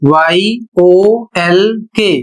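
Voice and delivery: very slow letter-by-letter spelling; spelling it out for students; spelled out. Shown as a demonstration. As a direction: spell वाईओएलके very slowly, one letter at a time. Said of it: "अगला शब्द है".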